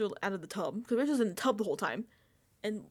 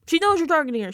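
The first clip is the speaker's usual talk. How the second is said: much louder, exasperated; exasperated outburst; 6 semitones higher, 13 dB louder, faster than usual. Real, not acted. Ranting at Mickey on screen, loud exasperation. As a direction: blurt it out at her.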